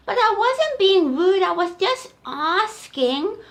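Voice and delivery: high, loud, slow protest; little elephant voice. Acted. Little elephant protesting to Auntie Giraffe, high. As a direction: protest innocently like a small calf.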